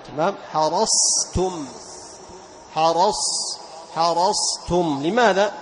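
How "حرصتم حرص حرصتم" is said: حرصتم slow, ص kept full and heavy before ت; emphatic sad. Shown as a demonstration. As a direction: keep the ص of حرصتم from sliding to س. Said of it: "لأن وقوع التاء المهموس بعد الصاد".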